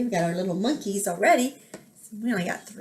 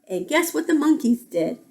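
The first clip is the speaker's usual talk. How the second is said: plain reading voice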